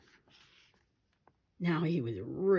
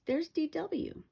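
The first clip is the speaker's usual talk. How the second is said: mild shout; 11 semitones higher, slower than usual. A narrator's reading. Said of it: "shouted the Tibble twins".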